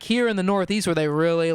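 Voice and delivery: ordinary delivery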